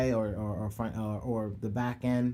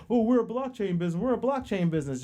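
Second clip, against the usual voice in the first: mock corporate voice; 7 semitones higher, faster than usual. Mocking what companies will say.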